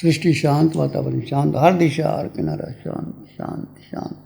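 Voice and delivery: calm, spaced-out phrases settling into a low voice; guided meditation. Real, not acted. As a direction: guide them inward, letting phrases sink and fade.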